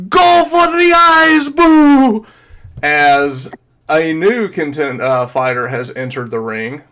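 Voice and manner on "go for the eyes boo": huge high booming battle cry; a giant hero's booming call across the stables. Acted. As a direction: bellow it out like a giant hero. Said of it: "this booming voice say".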